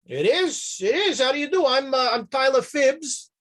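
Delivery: lightly voiced cheerful saloon owner; friendly barkeep. GM voicing the bartender.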